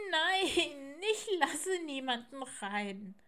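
high, whiny owlet wail; tearful refusal. Acted. Little owl starts crying behind the door, tearful refusal. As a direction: draw out the no as if starting to cry. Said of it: "fing an zu weinen".

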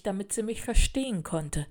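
ordinary delivery

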